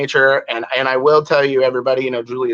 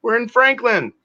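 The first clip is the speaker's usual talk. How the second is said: excited greeting; 10 semitones higher, slower than usual. Real, not acted. Host greets a neighbour.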